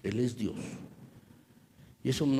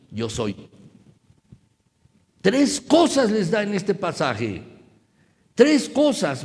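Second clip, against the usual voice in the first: rising, louder preacher's cry; preaching at full force; 5 semitones higher, 8 dB louder than usual. Acted. Preacher urging brothers not to fear, full force. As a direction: preach it out to the congregation.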